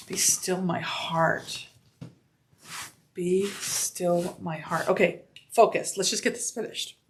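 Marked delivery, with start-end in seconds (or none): 0.1-4.9 swooning. Real, not acted. Swooning over the bag.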